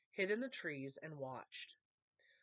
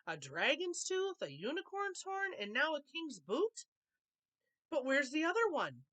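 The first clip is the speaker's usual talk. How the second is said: greedy elf; 8 semitones higher, 3 dB louder than usual. A narrator's reading. Wicked Elf listing his loot, high gloating voice. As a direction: count the treasures with glee.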